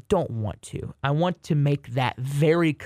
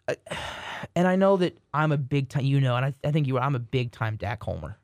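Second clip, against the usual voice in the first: normal talking voice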